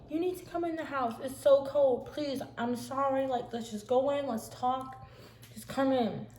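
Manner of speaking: high-pitched, insistent imitated plea; urgent pleading. Sister apologizing and begging Rebecca inside, urgent pleading. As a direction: coax and beg her indoors.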